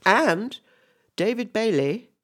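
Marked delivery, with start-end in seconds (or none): none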